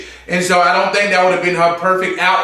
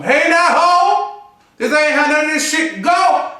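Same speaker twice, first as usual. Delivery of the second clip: Jennifer Lewis; 9 semitones higher than usual. Retold or imitated. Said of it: "I sound like Jennifer, uh, Jennifer, uh, Lewis".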